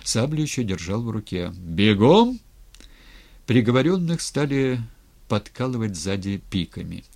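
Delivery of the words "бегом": loud order, rising at the end; shouted command. A narrator's reading. Rebel leader hurrying prisoners with sabre in hand, shouted command. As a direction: shout to hurry them along. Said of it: "крикнул Степан".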